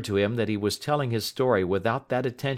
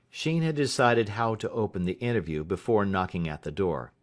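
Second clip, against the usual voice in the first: ordinary delivery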